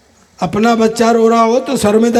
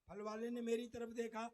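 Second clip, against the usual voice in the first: ordinary talk, nothing marked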